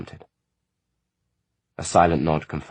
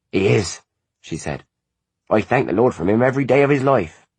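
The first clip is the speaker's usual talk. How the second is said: lightly higher, fervent mother's voice; proud mother; 6 semitones higher, 6 dB louder than usual. A narrator's reading. Mother praising her son to the guests, proudly. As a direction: swell with pride in her son.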